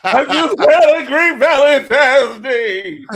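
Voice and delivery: high, slow fake sob through laughter; mock crying. Teasing co-host about crying all night, mock sobbing. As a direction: blubber it out as a joke, laughing. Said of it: "Like, you've been crying all night".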